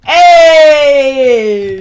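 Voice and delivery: loud, drawn-out holler; long shouted call. Real, not acted. Streamer calling out to a game character, long holler. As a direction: holler it and stretch it out.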